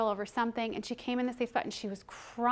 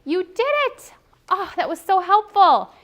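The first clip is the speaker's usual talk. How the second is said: much higher, louder cheering praise; teacher cheering a child's choice, much higher and louder; excited praise; 9 semitones higher, 12 dB louder than usual. Shown as a demonstration. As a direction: celebrate the child like they just won. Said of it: "the minute they make the choice".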